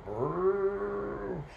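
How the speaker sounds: long, high rounded moo; cow mooing. A narrator's reading. Storyteller voicing the cow in the yard, long moo. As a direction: imitate a cow with one sustained moo. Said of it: "and the cow going".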